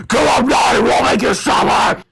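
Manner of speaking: high, loud, menacing villain voice; villain threat. Acted. Robot villain threatening the boys, loud menace. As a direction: snarl out the threat.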